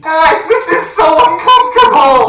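uncomfortable shout. Acted. To friends, wailing loudly about the awkward moment. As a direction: wail it out, squirming with embarrassment.

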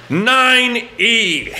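high, drawn-out shout of the seat number; defiant retort. Retold or imitated. Passenger giving his seat to the flight attendant, defiant shout. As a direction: fire it back loudly, re-enacting the outburst.

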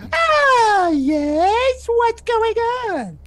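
very high, loud witch shriek; screaming witch. Acted. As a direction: scream turning into a greeting. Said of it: "And she stops her screaming".

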